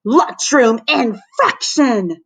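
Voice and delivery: slow, high-pitched monitor's bellow. A narrator's reading. Lunch monitor punishing a kid, bellowing. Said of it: "Missus Bunns would bellow".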